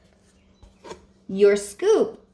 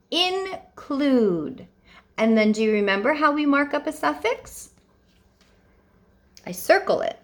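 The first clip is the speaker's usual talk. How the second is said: ordinary talk, nothing marked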